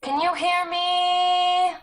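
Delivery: high, loud, slow, almost sung call. Acted. Sarah calling to Charlie far below, loud slow sung-out call. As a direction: let the question carry far.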